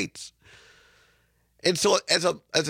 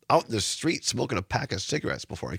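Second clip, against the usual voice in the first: ordinary delivery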